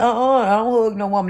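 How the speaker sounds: dismissive coworker. Retold or imitated. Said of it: "And she like".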